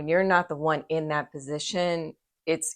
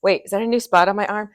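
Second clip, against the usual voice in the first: higher, louder, rushed self-worry; mock panic; 5 semitones higher, 8 dB louder than usual. Friend retelling her own panic to the doctor, hammed-up alarm. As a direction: ham up the panicked call to the dermatologist. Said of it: "And me, it's like".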